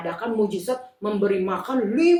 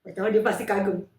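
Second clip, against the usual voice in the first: usual speaking voice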